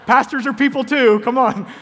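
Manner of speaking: high, loud mock indignation; playful protest. Real, not acted. Pastor defending watching TV to congregation, playfully indignant. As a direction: plead your case loudly, in jest.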